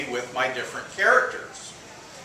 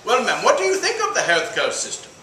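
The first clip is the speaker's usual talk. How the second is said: Indian caller, higher, lilting and polite; Indian accent; 7 semitones higher, 3 dB louder than usual. Acted. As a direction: put on an Indian accent for a survey call. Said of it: "The Indian calls the old woman".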